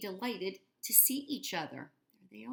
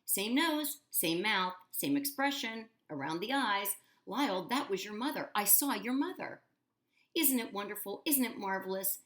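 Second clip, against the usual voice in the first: higher, louder excited gushing; excited crying out; 5 semitones higher, 5 dB louder than usual. A narrator's reading. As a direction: gush over the crocodile eagerly. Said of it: "Hector cried out".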